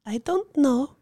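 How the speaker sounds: evasive woman's voice. Acted. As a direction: shrug it off lightly. Woman answers, refusing to be definite.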